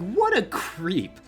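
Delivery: usual speaking voice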